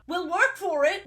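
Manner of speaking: sudden high-pitched mock blurt. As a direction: blurt it out like an over-keen character. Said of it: "Ned blurts out".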